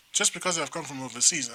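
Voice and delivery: normal talking voice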